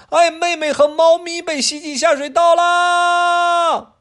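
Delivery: put-on very high, loud, slow child's cry; wailing kid. Adam crying out as his sister goes down the drain, slow high wail. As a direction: draw the wail out in alarm.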